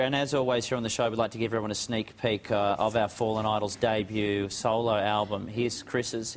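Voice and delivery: low, quick show-host announcement; announcer read. Acted. Host introducing the album reveal, low and quick. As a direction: wrap up the segment like a presenter.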